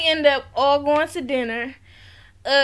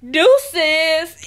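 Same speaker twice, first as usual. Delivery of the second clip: loud, punched DEUCES in imitation; loud mock dismissal; 6 dB louder than usual. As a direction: throw DEUCES out loudly. Acting out her sign-off.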